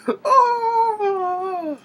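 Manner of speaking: very high mock yelp of pain; villain yelping after the bite; pained cry. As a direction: yelp like you've been bitten. Villain bitten in the groin.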